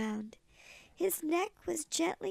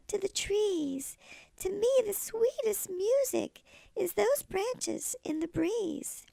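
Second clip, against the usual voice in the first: high, gentle little cricket voice; sweet old lady cricket; kindly cricket; 5 semitones higher than usual. Acted. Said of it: "coughed a cricket".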